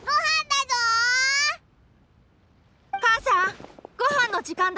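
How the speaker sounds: calling out. Acted. Child calling his mother to dinner, a long drawn-out call. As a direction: call everyone to dinner from afar.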